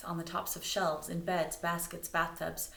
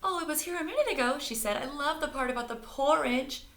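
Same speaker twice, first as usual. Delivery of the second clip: high, loud, fast breezy voice; chatty diner lady; 9 semitones higher, 5 dB louder than usual. Acted. Diner owner chatting to the searchers. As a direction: gush cheerfully.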